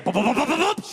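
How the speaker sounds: rapid popped "boop" footstep noises; imitated footsteps upstairs. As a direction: mimic feet pounding back up the stairs. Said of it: "She came back up the stairs".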